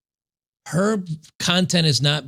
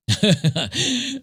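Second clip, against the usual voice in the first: short laugh; 7 semitones higher than usual. Real, not acted. Laughing at the comment.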